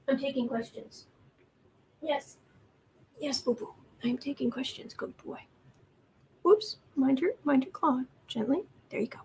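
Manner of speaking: hushed, tender cooing to a pet; soft cat talk. Real, not acted. Talking softly to her kitten during the stream, cooing. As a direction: murmur gently to the kitten on your lap.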